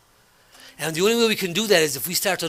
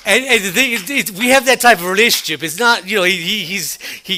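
louder, higher, laughter in the voice; laughing still; 4 semitones higher, 6 dB louder than usual. Real, not acted. Explaining the coworker friendship, still laughing loudly. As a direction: talk through the last of the laugh.

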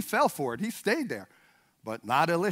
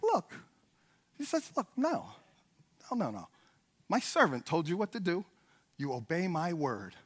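much softer voice, dropping low; quiet firm correction; 6 semitones lower, 12 dB quieter than usual. Acted. Prophet tells Naaman to obey his word, quietly firm. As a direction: lay down the order softly.

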